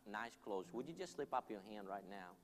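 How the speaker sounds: earnest preacher leading prayer over soft music; altar call. Acted. Preacher urging the crowd to come forward, earnest and pleading. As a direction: plead gently with the congregation to respond.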